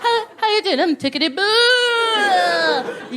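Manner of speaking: high, stretched, hammed-up chirp; mock cheery dad. Comic playing the sad dad answering his son, mock cheery. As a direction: ham up a dad who's too cheerful. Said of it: "check on your dad".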